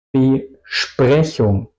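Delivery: "Besprechung" slowly, as be-shprechung; SP as SHP. Shown as a demonstration. Teacher giving a new example, "Besprechung" with a sh sound. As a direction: say "Besprechung" with sh opening the second syllable. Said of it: "digo Besprechung".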